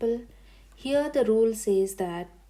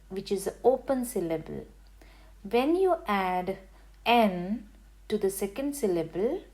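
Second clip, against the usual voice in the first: usual speaking voice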